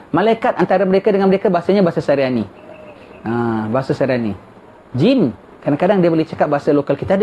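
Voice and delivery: ordinary talk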